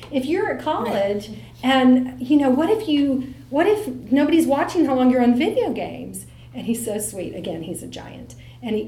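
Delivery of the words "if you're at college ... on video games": higher, louder, imitating her own motherly lecture; playing the fussy mom at her son; mock lecturing mom. Mom lectures her giant son about video games, lightly mock-stern. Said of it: "And I'm like".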